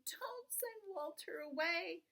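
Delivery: put-on high, slow, pleading kids; begging children. A narrator's reading. Children begging Father to keep the dog, high pleading. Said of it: "begged Betty and Billy".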